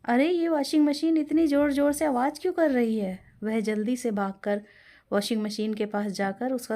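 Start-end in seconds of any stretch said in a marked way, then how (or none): 0.1-3.2 higher, louder alarmed question from the mother-in-law; jolted by the racket, demanding why; startled. Acted. Savitri startled by noise. (चौंककर ऊँची आवाज़ में)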